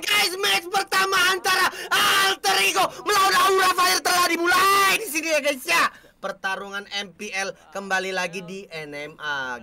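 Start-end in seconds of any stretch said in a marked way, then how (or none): 0.0-5.8 loud, hyped match announcement; live caster opening. Acted. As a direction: open the match like a shoutcaster. (pembukaan caster yang heboh)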